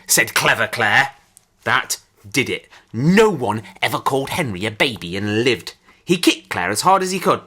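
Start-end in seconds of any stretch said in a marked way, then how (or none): none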